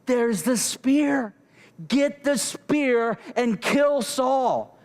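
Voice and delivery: slow, high-pitched, insistent urging; urging accomplice. Retold or imitated. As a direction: draw out each word, pressing him to act. Abishai urges David to kill.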